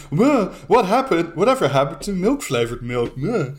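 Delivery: hammed-up grumbling complaint; mock whine ending on a dismissive "meh"; mock indignation. Mock outrage at flavoured milk.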